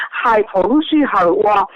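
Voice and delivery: ordinary delivery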